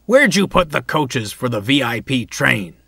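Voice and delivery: loud, stagey question. A narrator's reading. Bear asking Donald about the VIP coaches, loudly. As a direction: project it for an eavesdropper to hear. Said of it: "he said loudly".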